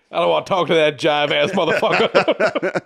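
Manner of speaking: loud, high, slow put-on American voice; hammed-up refusal. Civil rights leader refusing a phone call from the bath, hammed-up voice. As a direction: draw it out loudly as a comic character.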